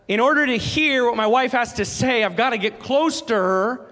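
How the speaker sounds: rising sermon intensity; preaching. Acted. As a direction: press the point home to the congregation. Preaching at full force.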